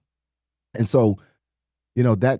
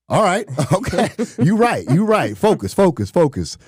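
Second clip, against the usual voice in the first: high, quick, repetitive self-talk; hasty mock backing down; 10 semitones higher, 4 dB louder than usual. Player conceding to equipment manager, acted hasty agreement. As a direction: give in at once, high-pitched and half laughing. Acts out backing down after warning.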